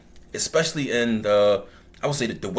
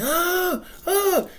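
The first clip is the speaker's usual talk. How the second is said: imitated screams. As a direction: imitate grown men screaming in a high voice. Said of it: "you hear grown men screaming".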